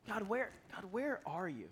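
soft, hushed plea to God; subdued questioning of God; pleading prayer. Retold or imitated. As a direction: retell a lost, quiet cry for help.

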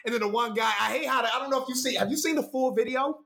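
ordinary talk, nothing marked

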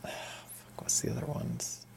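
muttered under the breath; whispered to self. Real, not acted. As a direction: whisper it to yourself while trying to remember. Muttering to himself, forgetting a title.

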